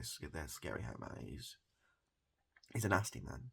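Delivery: usual speaking voice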